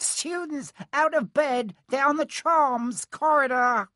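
piercingly high drawn-out alarm call; Peeves, bellowing. A narrator's reading. Peeves giving the pupils away to Filch, bellowing. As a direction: call it down the corridor, high and slow. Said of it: "Peeves bellowed".

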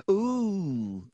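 put-on high, drawn-out little boy's wonder; eager, awed exclamation; fascinated. A narrator's reading. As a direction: coo in high-pitched fascination.

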